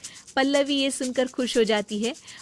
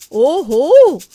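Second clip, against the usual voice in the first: much louder, higher ohho; caught you; 6 semitones higher, 15 dB louder, much slower than usual. Acted. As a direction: catch the shirker red-handed. Catches the sister-in-law resting. (बहाना पकड़कर ज़ोरदार ओहो)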